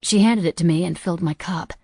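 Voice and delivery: ordinary delivery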